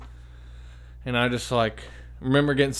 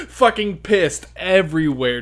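loud, higher, slow through laughter; laughing, stretched and pitched up; real amused outburst; 7 semitones higher, 6 dB louder, slower than usual. Real, not acted. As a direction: crack up as you confess the mess. Laughing at his own mishap.

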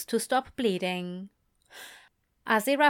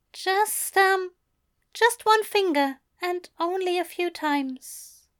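higher bashful voice; shy Aziraphale; 9 semitones higher than usual. A narrator's reading. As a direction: admit it shyly.

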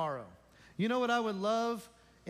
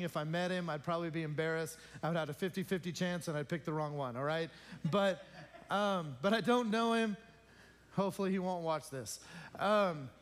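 ordinary talk, nothing marked

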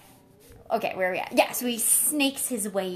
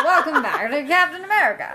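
louder, far-off mock show host voice; mock announcer; 5 semitones higher, 5 dB louder than usual. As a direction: announce it like a TV intro. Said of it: "announcer voice".

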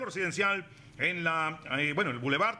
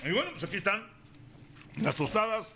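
normal talking voice

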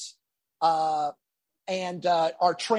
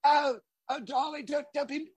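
soft higher Birmingham plea, fading; 6 semitones higher, 4 dB quieter than usual. Retold or imitated. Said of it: "[name]'s going".

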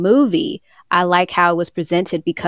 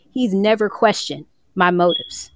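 ordinary delivery

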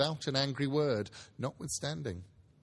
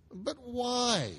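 a higher, startled plea; crying out; 10 semitones higher than usual. Acted. Nephew crying out to his uncle, startled and higher. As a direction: cry out the question in dismay. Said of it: "cried Scrooge's nephew".

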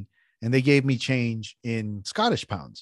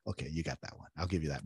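low, soft aside as the wife; wife's grudging approval; 7 semitones lower, 13 dB quieter, faster than usual. Retold or imitated. Wife approving his accent attempt, quiet grudging concession. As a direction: concede it quietly, lightly imitated. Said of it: "She's all".

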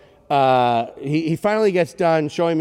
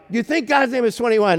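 higher, incredulous retold question; 8 semitones higher than usual. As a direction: ask it pitched up, in disbelief. Her exasperated repeated question.

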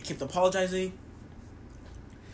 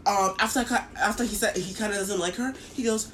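normal talking voice

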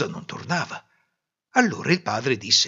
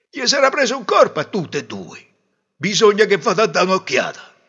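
loud, raised grumbling voice; impatient father; 8 semitones higher, 8 dB louder than usual. Acted. Father waiting for the women, loud impatient grumbling. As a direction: grumble loudly and decide to go.

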